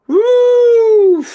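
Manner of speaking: long, high, loud whoop; loud woo. Real, not acted. Host sighing over coming typhoons and busy season, long high woo. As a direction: let out one sustained high woo.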